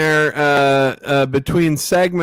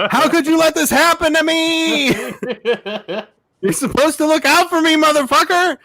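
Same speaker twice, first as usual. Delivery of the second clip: high-pitched mock outrage at bank; 12 semitones higher than usual. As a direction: shriek it, betrayed.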